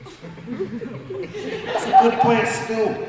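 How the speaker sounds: slow, high, laughing repeat; real laughter through a repeated line. Real, not acted. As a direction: laugh the words out as you repeat them. Repeats his own line, laughing.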